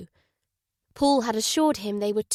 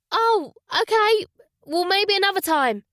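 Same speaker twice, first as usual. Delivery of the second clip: hide the letdown lightly; woman accepting a friend can't stay; disappointed; 10 semitones higher, 5 dB louder than usual. Acted. Laura disappointed, voiced higher.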